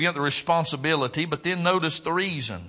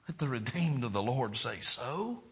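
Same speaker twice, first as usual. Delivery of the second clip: quiet repeat; 6 dB quieter than usual. Shown as a demonstration. Pastor repeating the call quietly to the church. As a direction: bring it down to a hush. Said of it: "the same line repeated softly".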